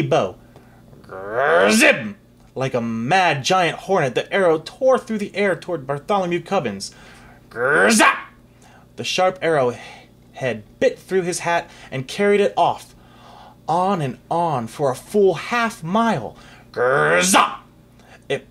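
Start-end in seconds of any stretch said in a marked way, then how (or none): 1.0-2.2 growled buzzing arrow noise; zippy growled arrow whoosh; arrow whizz. A narrator's reading. Arrow whizzing sound effect. 7.5-8.3 growled buzzing arrow noise; zippy growled arrow whoosh; arrow whizz. A narrator's reading. Arrow sound effect. 16.7-17.6 growled buzzing arrow noise; zippy growled arrow whoosh; arrow whizz. A narrator's reading. Arrow sound effect.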